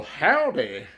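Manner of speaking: a light American country twang on "Howdy"; a hint of a hammed-up American country accent; mock American country accent. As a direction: imitate the Irish mocking a Southern American drawl, lightly. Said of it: "automatically to that country accent".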